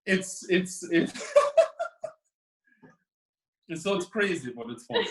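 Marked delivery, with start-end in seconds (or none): 1.2-2.1 laugh. Real, not acted. Laughs again after stare off.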